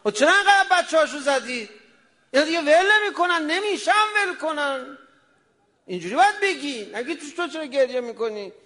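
loud, high impassioned sermon voice; a preacher's passionate outcry; fiery preaching. Acted. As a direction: preach it at full force, rising in pitch. Preacher raises voice to full force.

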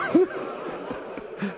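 wordless weeping; sobbing. Real, not acted. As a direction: break off into breathy sobs. Preacher weeps during the lament.

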